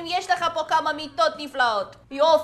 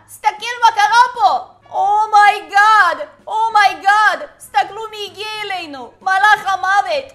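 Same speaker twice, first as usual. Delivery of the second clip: mock horror; 4 semitones higher, 8 dB louder than usual. Host reacting to the Angel of Death arriving, loud mock horror. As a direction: stretch each exclamation in playful shock. Grim Reaper arrives in the game.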